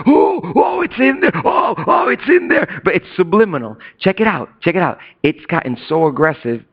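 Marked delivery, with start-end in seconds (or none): 0.1-2.8 hammed-up hysterical shrieking; mock freak-out. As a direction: imitate a crowd losing it. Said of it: "everybody was freaking out".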